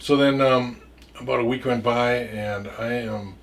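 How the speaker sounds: usual speaking voice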